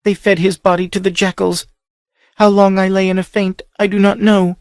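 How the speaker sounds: high, rising distress; grief-stricken. A narrator's reading. Captive girl recalling her brother's death, distraught. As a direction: near breaking with horror. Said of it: "She gagged".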